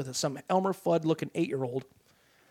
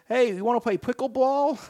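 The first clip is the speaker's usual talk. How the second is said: mock kid interviewer; 6 semitones higher than usual. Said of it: "He's got this Elmer Fudd voice".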